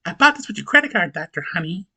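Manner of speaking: mock rich ex-wife. Recapper voicing the ex-wife showing off her fur coat, mock smug. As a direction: lightly voice her flaunting the purchase. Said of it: "she comes in wearing a fur coat".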